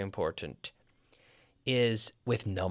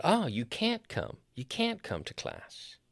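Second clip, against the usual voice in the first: slow, high Oh, then softer; dawning realization; 4 semitones higher, 4 dB quieter than usual. Retold or imitated. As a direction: let the misunderstanding slowly click. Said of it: "And I said".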